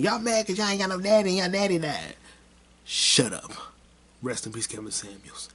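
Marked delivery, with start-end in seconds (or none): 0.0-2.2 mocking imitation.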